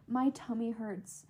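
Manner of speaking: soft, slow retold whimper; softly and slowly, feeling poorly; small pitiful complaint. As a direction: imitate a quiet, sorry little complaint. Retelling her friend's anxious complaint.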